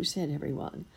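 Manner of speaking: ordinary delivery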